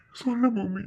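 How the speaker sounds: tearful plea; grieving man begging Bhoomi for comfort; crying. Acted. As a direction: beg softly through tears.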